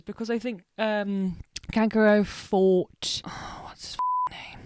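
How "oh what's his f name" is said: whispering to herself while searching for a name; a quick breathy aside to herself; muttered whisper. Real, not acted. Forgets a name mid-sentence.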